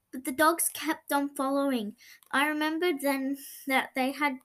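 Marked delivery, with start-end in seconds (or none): none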